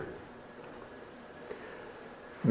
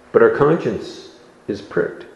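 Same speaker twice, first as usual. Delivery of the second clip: usual speaking voice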